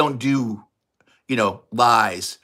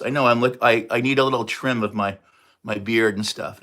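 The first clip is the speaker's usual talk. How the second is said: normal talking voice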